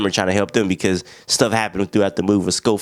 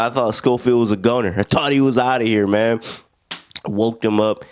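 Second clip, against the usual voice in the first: ordinary talk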